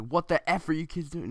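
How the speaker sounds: loud, high-pitched angry outburst; angry cursing man. Retold or imitated. Angry fat guy confronting kids on the roof, loud outraged yell. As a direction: burst out scolding trespassing kids. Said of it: "he starts cursing".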